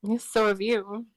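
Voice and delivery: ordinary delivery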